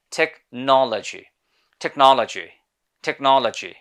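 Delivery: British "technology", strong rounded NOL, reduced lo; British pronunciation. Shown as a demonstration. Teacher corrects the local habit, British accent. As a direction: drill a British accent, stressing the second syllable. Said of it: "British people এটাকে উচ্চারণ করে থাকে".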